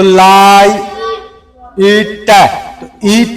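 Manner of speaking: लाइट split into लाई and इट, each drawn out; sounding out syllables. Shown as a demonstration. Teacher showing students the pronunciation, syllable by syllable. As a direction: sound out लाई, pause, then इट. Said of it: "अब इसका उच्चारण देखना".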